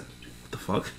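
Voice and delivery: hushed stunned aside; whispered disbelief. Retold or imitated. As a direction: mouth it under your breath. Retold reaction, breathed out.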